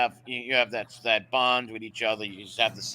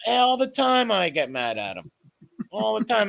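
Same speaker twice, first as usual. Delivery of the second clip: ordinary delivery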